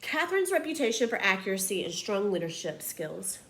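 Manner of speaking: plain reading voice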